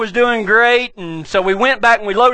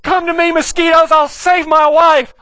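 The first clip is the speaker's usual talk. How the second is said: mock heroic call; 6 semitones higher than usual. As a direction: play the brave cowboy for laughs. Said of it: "I was like".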